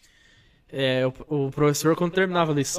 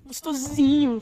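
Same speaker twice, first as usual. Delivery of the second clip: much higher, teasing echo of gostosinho; mock cutesy echo; 11 semitones higher, much slower than usual. Echoing his friend's word as a high teasing joke. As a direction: sing out gostosinho in a mock sweet voice.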